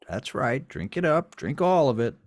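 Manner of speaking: loud, rising, relentless coaxing; commanding urging. Acted. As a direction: push them to drain the glass. Garwood urges him to drink.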